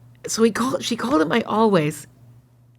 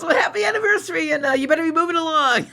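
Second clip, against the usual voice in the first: high, amused retelling of a cop; imitated officer, pitched up; retold policeman's reply; 8 semitones higher than usual. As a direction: send them on their way, half laughing.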